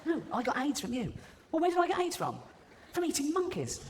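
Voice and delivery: high, squeaky bickering; indignant squabble. Acted. Man and chimp argue over blame, indignant. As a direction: bicker back in a comic high-pitched voice.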